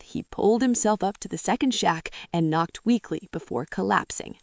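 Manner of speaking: ordinary talk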